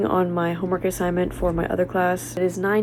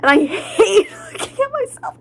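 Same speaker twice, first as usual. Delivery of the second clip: high, giggly self-mockery; laughing; 13 semitones higher, 8 dB louder than usual. Real, not acted. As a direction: laugh through the words. Laughs at herself.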